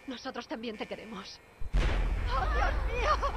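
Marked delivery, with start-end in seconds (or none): 1.8-3.3 high, loud scream of terror; terrified scream. Acted. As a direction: scream at the blast. Nuclear blast seen from platform. (grito de terror)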